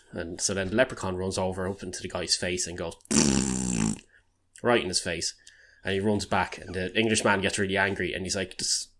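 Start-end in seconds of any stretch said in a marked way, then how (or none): 3.0-4.0 blown wet raspberry; leprechaun's raspberry. Retold or imitated. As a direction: blow a rude raspberry in his face. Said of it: "Blowing raspberries, everybody".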